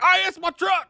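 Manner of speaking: hammed-up movie impression; imitating mullet dude. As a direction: holler it high like an outraged truck owner. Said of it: "Remember the mullet dude from Halloween six?".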